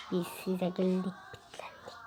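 unvoiced whisper finishing the counting rhyme. Picking a player, he whispers the rhyme's end. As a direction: breathe the last words of the rhyme.

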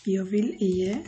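usual speaking voice

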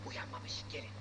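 soft hushed whisper. Acted. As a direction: whisper so she stays asleep. Child asleep, sneaking in.

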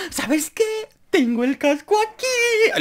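high, slow, put-on mum voice; a giddy mum showing off her helmet; excited mother. Mother telling Torres about her helmet, high and slow.

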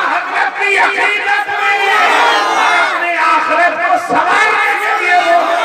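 high, loud, drawn-out rallying call; fiery preacher's exhortation; preaching at full force. Acted. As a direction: lift the whole hall with a stretched shout. Rousing the crowd to chant.

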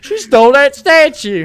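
high, loud, dopey hero voice; mocking Spider-Man. Friend joins mocking the Spider-Man line, high and loud. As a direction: blurt it like a clueless hero. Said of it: "This is the way he says it".

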